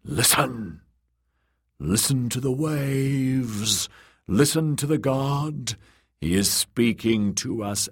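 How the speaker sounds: drawn-out, incantatory priest's call; slow sermon rising to a decree; prophet proclaiming. Acted. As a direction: hush them, then proclaim the god's word. Priest proclaims god's words.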